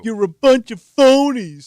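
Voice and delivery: slow, loud, drawn-out jeer; mock yell. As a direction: bellow it like a heckler. Co-host yells at fake-friendly states.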